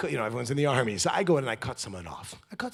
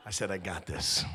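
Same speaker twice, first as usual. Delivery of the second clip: low reassuring; 7 semitones lower, 9 dB quieter than usual. Retold or imitated. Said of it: "I'm like".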